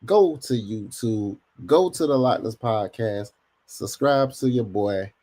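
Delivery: slow, drawn-out subscribe plea; slow plug. Real, not acted. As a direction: spell out the plug slowly for viewers. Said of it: "For those who haven't subscribed".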